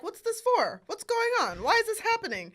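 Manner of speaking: high, puzzled villagers asking; confused townsfolk. Retold or imitated. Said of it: "they're like".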